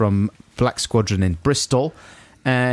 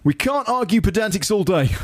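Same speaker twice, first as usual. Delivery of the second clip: high, put-on colleague voice; blustering office chap; 7 semitones higher than usual. Reading a list of office malapropisms, high character voice. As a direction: deliver each mangled saying as the chap. Acts out office chap's phrases.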